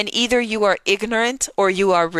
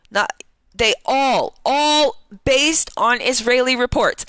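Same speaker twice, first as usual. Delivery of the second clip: ordinary talk, nothing marked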